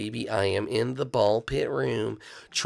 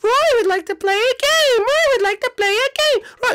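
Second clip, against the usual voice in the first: high loud put-on voice; 8 dB louder than usual. Game voice repeats the line.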